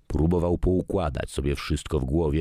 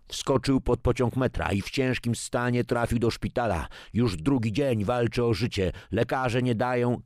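higher, urgent newsreader delivery; news report; 8 semitones higher than usual. Acted. Newsreader reports a critical hospital case, urgent broadcast style. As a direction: read it like a TV news bulletin.

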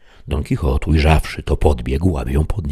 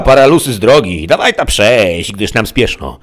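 higher, louder rude peasant voice; rough peasant scolding; 6 semitones higher, 6 dB louder than usual. A narrator's reading. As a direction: bark them out of the way. Peasant girl chases them off.

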